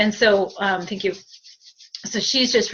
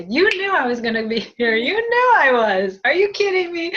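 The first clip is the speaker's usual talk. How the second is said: higher and louder mock disbelief; tease, building higher; sassy teasing; 7 semitones higher, 5 dB louder than usual. Medium voicing sister's teasing return, higher and louder. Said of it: "She goes back to the".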